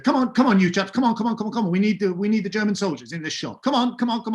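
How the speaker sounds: chivy the extras along like a flustered producer; bossy producer. Retold or imitated. Assistant producer hurrying the extras, hammed-up bossy call.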